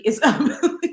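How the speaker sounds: breaking up into laughter on the last word; real laugh bursting through the words; laughing hard. Real, not acted. Reacting to a man's silly Facebook name, cracking up laughing. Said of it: "I'm laughing in this live".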